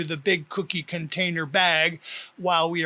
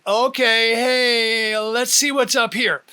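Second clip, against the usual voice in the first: higher, louder busy uncle voice; brisk uncle; 4 semitones higher, 6 dB louder than usual. A narrator's reading. Uncle rummages for a container, brisk and louder. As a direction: bustle about looking in the cupboard.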